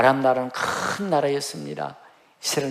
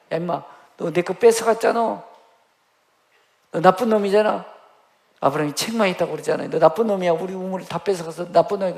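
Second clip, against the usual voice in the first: loud, higher scolding rebuke; Abraham loudly scolding the thief king; acted scolding; 4 semitones higher, 7 dB louder than usual. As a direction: tell him off as the wronged one.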